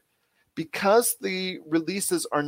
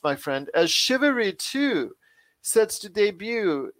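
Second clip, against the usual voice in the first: hammed-up olde English accent, much higher and slow; bad English accent; 5 semitones higher than usual. Host introducing Chivalry Two to listeners, in a hammed-up bad English accent. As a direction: put on a deliberately terrible merry olde English accent, drawn out. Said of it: "in the world's worst English accent that I did".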